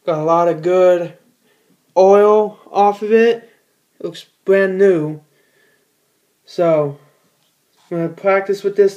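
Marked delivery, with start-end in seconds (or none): none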